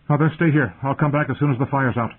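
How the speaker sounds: pressing, high-pitched command; urgent. A narrator's reading. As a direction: give orders as you rush off. Son rushing off to a fire.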